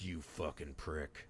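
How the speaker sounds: very soft, slow, low muttered curse; muttered insult. Retold or imitated. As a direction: grumble it under your breath at the screen. Angry at character on screen.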